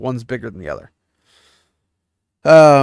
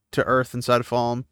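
ordinary delivery

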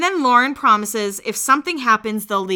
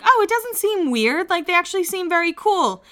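pleasantly surprised; 9 semitones higher than usual. Retold or imitated. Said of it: "I was like".

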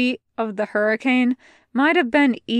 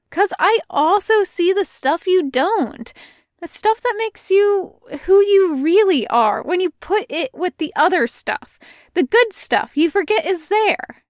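Luffy; 8 semitones higher, 4 dB louder than usual. Acted. Luffy voiced, higher, earnest.

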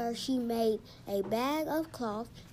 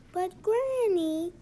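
drawn-out, higher, louder plea to Granny; child's protest; 7 semitones higher, 6 dB louder, slower than usual. A narrator's reading. Patrick objects to getting ready for bed, protesting. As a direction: stretch it out as a pleading objection.

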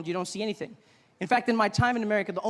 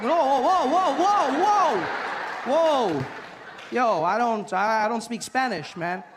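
high, loud whoa whoa backing off; alarmed, waving them off; panicked protest; 4 semitones higher, 5 dB louder, slower than usual. Retold or imitated. He backs off from Spanish speakers, high and loud whoa.

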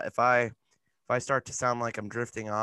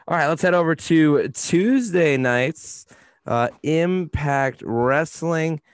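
louder, slow segment announcement; announcer; 3 semitones higher, 7 dB louder, slower than usual. Acted. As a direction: announce the next show.

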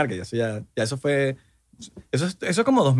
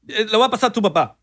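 mock mom threat; 6 semitones higher than usual. Voicing mom in acted quarrel.

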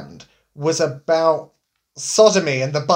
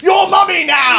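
high, loud barked order; mock shout; 12 semitones higher, 7 dB louder than usual. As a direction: bark it as a mock command. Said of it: "slammed it down on the table".

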